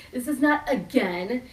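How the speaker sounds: ordinary talk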